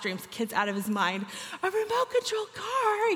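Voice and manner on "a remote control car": pitched way up in delight; echoing the boy's joy, voice leaping high; excited squeal. Retold or imitated. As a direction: squeal it like a thrilled little kid. Echoing the kid's thrill.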